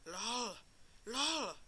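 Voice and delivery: lol turns into a loud, high no; escalating from chuckle to alarmed yell; rising panic. Real, not acted. Gets eaten, reacts rising.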